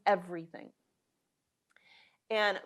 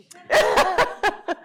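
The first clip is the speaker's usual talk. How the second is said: embarrassed laugh; 11 semitones higher, 10 dB louder than usual. Real, not acted. Laugh after composing herself.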